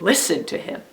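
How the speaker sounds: "Listen" stressed, "to him" tucked after it. Shown as a demonstration. Teacher recapping the three readings for children, stress on "Listen". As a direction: put the weight on "Listen". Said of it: "when you stress a different word".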